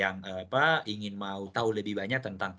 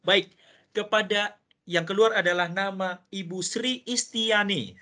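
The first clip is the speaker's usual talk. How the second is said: upbeat, higher-pitched prize call; booming prize announcer; 6 semitones higher, 4 dB louder than usual. Acted. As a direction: announce it like a game show host. Host reveals third winner.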